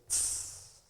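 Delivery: soft voiceless "tss" of irritation; huffy hiss. Acted. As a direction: let out an annoyed little hiss. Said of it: "she just lets her reaction come up".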